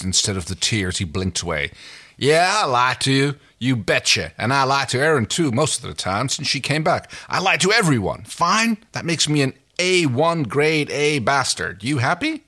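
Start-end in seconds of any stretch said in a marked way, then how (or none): 2.2-12.4 higher, louder angry outburst; fiery defiance. A narrator's reading. Crichton admitting his lies to Dargo, fiery and defiant. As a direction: throw the confession back in his face. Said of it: "fire in his eyes".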